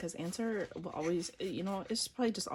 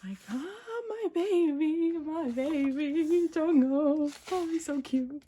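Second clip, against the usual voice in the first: high, loud, drawn-out wail of adoration; fangirl squealing; 9 semitones higher, 7 dB louder, slower than usual. Real, not acted. Fan cooing over a baby-faced favorite, overwhelmed squeal. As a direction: squeal and stretch every word.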